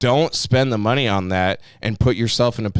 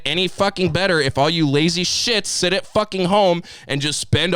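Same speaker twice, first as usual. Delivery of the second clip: heated rant; 7 semitones higher than usual. Real, not acted. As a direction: pitch climbing, fired-up rant. Ranting, building up.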